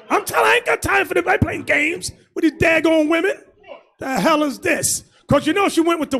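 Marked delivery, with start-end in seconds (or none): 0.1-3.3 high, fast exasperated shout; fed-up shout. Real, not acted. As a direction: blow up at them, fast and high. Preacher ranting about wives.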